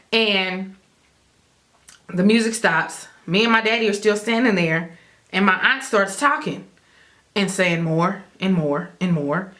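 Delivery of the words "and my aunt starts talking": ordinary talk, nothing marked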